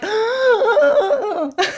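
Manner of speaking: long, loud, high wheezing inhale; wheezing gasp. Retold or imitated. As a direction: act out choking for breath. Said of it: "wheezing. I'm like".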